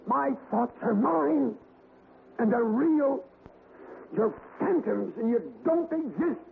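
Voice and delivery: fighting terror with conviction; frightened defiance. Acted. Alex denying the phantoms are real, high frightened defiance.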